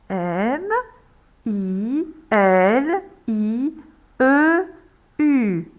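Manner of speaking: "I L I" very slowly, letter by letter; slow spelling. Shown as a demonstration. Teacher spelling again for pupils, very slowly. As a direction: drag each letter as the pen moves. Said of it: "et on recommence".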